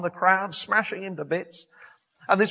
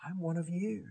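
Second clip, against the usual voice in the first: "I'm one of you" dropped to a soft, quiet voice; hushed plea; 11 dB quieter than usual. Acted. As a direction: say "I'm one of you" softly, rising slightly at the end. Same plea repeated after pause.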